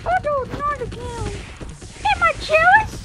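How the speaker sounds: very high, loud wail; dismayed outcry. Real, not acted. Child watching the race go wrong again, dismayed outcry.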